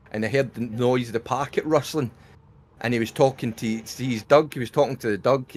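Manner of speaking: usual speaking voice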